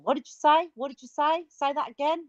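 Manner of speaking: baffled asking. Retold or imitated. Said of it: "you can imagine".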